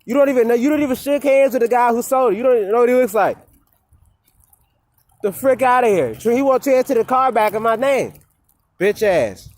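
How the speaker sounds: loud, high furious rant at the roommate; real shouting match over a stolen car; angry yelling. Real, not acted. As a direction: yell it, fed up and outraged.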